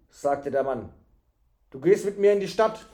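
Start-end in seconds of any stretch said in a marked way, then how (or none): 1.7-2.9 put-on firm, louder order to the boy; a stern townsman giving orders; commanding man. A narrator's reading. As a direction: tell him he's coming along, no argument.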